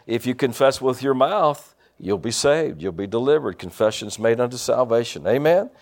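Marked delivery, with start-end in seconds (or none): none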